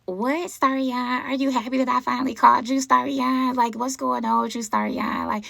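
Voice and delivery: high, slow put-on girl voice; forced girly voice. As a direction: mimic her forced, sugary voice. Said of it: "a forced voice".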